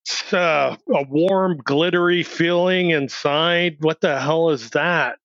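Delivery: as if feeling something brand new, slowly; dazed wonder. Retold or imitated. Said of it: "I'm like".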